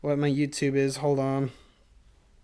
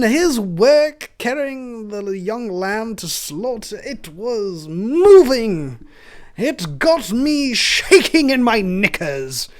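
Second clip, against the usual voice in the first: put-on slow, higher British accent; effeminate British scout; 4 semitones higher, 4 dB louder, much slower than usual. Scout gushing about the lamb boy, British accent. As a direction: gush slowly like a British luvvie. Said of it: "an effeminate British man".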